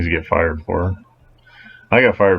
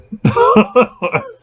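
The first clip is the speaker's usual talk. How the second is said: high laugh. Real, not acted. Guard laughs after telling how he got fired, high laugh.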